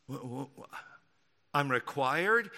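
low, very soft sputter; mock stunned stammer. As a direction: stammer as if taken aback. Acts stunned listener hearing requirement.

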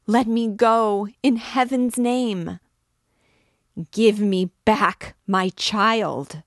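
frantic, full-voiced begging; desperate cries. A narrator's reading. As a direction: cry out in desperation, loud and drawn out. Struggle over a stolen child.

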